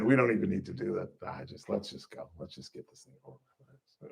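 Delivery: resigned mutter. Retold or imitated. Himself being arrested, quietly resigned. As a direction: give up calmly. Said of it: "I even said".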